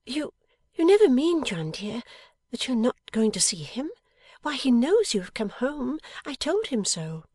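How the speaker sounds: high-pitched wifely protest; Bella, astonished wife. A narrator's reading. Wife objects that he must see his visitor, astonished. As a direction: protest in disbelief, pitched well up.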